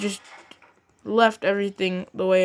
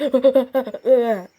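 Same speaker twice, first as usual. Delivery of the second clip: panicked laugh; 4 semitones higher, 4 dB louder than usual. Acted. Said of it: "He was like".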